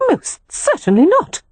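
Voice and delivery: high, indignant woman's voice. A narrator's reading. Meredith refuses Leo's apology, indignant. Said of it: "Meredith said indignantly".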